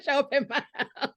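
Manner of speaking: reading through laughter; giggling over the comment; laughing. Real, not acted. Reading a viewer comment, laughing.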